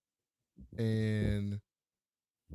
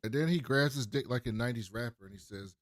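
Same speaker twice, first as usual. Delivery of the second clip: ordinary talk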